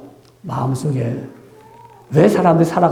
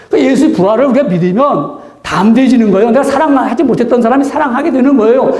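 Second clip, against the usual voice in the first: higher and louder, climbing to a full sermon peak; fervent preaching; 6 semitones higher, 3 dB louder than usual. Real, not acted. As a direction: proclaim it with rising fervor. Climactic preaching.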